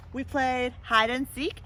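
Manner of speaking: storybook read-aloud voice; lively picture-book reading; story narration. A narrator's reading. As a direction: tell it to a child at bedtime.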